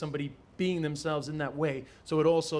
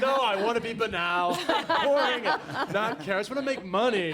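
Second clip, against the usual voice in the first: louder, laughing, mock-earnest boast; laughing mock confession; 6 semitones higher, 4 dB louder than usual. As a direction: joke that you just want money, laughing. Joking he wants to be boring.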